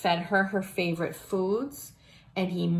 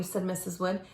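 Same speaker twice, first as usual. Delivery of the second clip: plain reading voice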